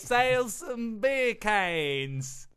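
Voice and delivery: hammed-up fake Aussie voice; a badly faked Australian; bad Australian accent. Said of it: "That's terrible".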